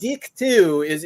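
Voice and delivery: "deck" said as "deek", slow; New Zealand DECK. Retold or imitated. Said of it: "They say deck differently".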